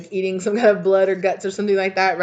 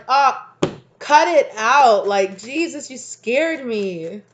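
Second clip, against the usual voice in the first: loud and slow, relieved; relieved scolding; 5 semitones higher, 6 dB louder, much slower than usual. Pretend. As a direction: drag it out, shaken but amused.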